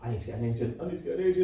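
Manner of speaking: hurried mock fretting, repeated in rising alarm; mock panic. Drama graduate imitating classmates desperate for agents, mock panic. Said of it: "all my year were panicking".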